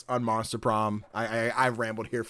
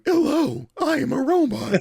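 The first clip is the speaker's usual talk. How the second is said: very high, mock robot voice; Mark Zuckerberg; 13 semitones higher, 4 dB louder than usual. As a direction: do a jokey stilted robot. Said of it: "He would do the joking, like, Mark Zuckerberg".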